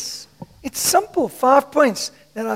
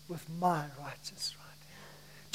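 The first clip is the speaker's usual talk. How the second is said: a hushed whisper of God's promise; 6 semitones lower, 25 dB quieter than usual. Acted. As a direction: breathe the words, barely voiced. Preacher quotes God, whispering.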